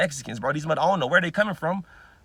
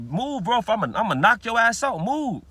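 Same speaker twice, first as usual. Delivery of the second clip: raised, louder, snapping at a stranger; angry command to move; angry retold yell; 6 semitones higher, 4 dB louder than usual. To a man crowding him in Dollar Tree, an angry raised yell. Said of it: "I'm like".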